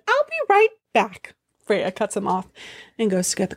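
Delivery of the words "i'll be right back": much higher, breezy quick exit line; breezy Loki. Acted. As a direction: toss it off high and airy as you dash. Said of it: "in typical Loki fashion".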